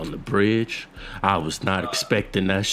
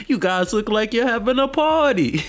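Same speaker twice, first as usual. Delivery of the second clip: high mock character voice; 13 semitones higher than usual. Friend quoting Knox for laughs, high mock voice. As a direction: push the imitation way up in pitch.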